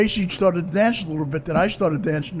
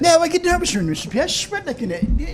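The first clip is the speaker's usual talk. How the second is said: mock English gent; 10 semitones higher than usual. Improvised little play.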